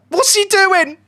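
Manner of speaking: high, loud cry of disbelief; exasperated yell. Real, not acted. Streamer to his own player after a missed chance, exasperated yell. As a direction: yell at the player who wasted it.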